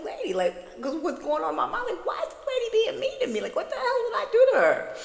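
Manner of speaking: high, fast, laughing confusion; laughing disbelief. Retold or imitated. Wondering why the court lady is mean, laughing high. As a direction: laugh in baffled outrage.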